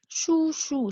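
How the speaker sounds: शूशू said high with falling tone; Chinese word shushu. Shown as a demonstration. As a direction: say शूशू slowly, pitch starting high then falling. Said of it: "शूशू means uncle".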